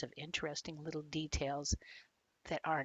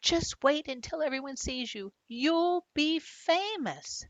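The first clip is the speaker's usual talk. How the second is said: excited; 9 semitones higher than usual. Acted. Boy telling his goldfish he'll be famous, high thrilled. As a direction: gush with pride, stretching famous.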